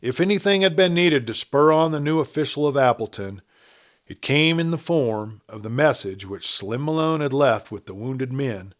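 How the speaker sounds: plain reading voice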